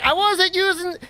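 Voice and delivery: a light Bronx Italian guy protesting; imitating a Bronx Italian gamer denying it; Bronx Italian accent. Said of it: "a Bronx Italian accent".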